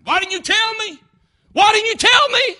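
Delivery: high, loud anguished shout; accusing cry from hell. Acted. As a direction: cry it out as a lost soul blaming a friend. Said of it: "they'll probably call out your name".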